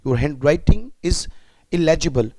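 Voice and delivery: very slow, each word laid out for students to echo; slow classroom drill; slow repeat-after-me. Shown as a demonstration. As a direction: say it slowly so the class can follow. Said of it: "sentence बोलिएगा मेरे साथ".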